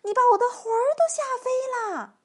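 high, loud, fast panicked squeal; scared-out-of-her-wits old lady; frightened cry. A narrator's reading. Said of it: "瘦小的老妇人喊起来".

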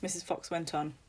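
plain reading voice

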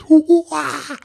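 high, loud monkey hooting; playful "hoo hoo ah" monkey call; monkey hoots. Acted. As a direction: whoop like an excited monkey on stage. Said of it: "the monkey comes on stage and goes".

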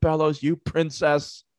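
high, drawn-out imitated taunt; mock bench chirp. Imitating player heckling from the bench.

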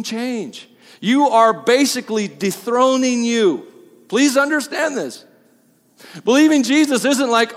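loud, high-pitched, punchy sermon delivery; impassioned preacher driving home each phrase; full-force preaching. Real, not acted. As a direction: preach it hard to the whole room.

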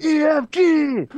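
very high, slow, drawn-out letters; hammed-up recitation. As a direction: stretch each letter out, manic and high. Hammed-up impression after praising Cage.

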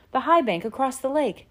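high, loud eager answer; excited. Acted. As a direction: answer in a rush.

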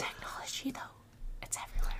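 a breathy whispered aside; whispering. Real, not acted. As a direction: whisper it under your breath. Aside about technology.

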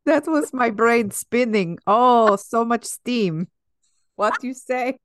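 put-on comic, puzzled Russian accent. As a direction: play baffled in a fake Russian accent. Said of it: "Stick to Russian".

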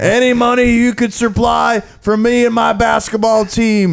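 high, pleading subway beggar voice; homeless subway panhandler. Acted. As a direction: beg for donations on the train. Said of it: "I'm doing the homeless voice in New York City".

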